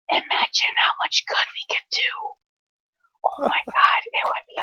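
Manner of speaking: excited hushed whisper. Acted. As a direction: whisper it like a secret plan.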